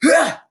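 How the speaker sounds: high, loud effort cry; mighty grunt. A narrator's reading. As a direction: heave it out with the throw. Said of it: "with a mighty grunt".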